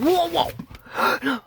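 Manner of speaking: high, loud imitated whoa; imitating him rolling over, shouting whoa; mock exclamation. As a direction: mimic his mocking cry. Imitating him during assault.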